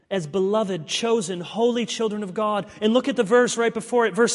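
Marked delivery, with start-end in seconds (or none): none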